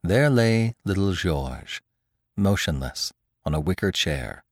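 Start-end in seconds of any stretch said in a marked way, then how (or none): none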